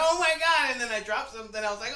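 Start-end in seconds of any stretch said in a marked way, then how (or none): 0.0-0.7 high, loud panicked exclamation; mock shriek of dismay. Retelling a clattering vet mishap.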